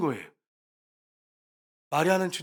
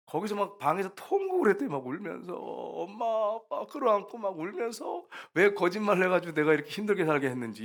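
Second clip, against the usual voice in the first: normal talking voice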